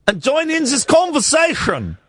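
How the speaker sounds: put-on high, pleading Swiss accent; mock-wistful comic Swiss accent. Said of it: "It's a Swiss accent".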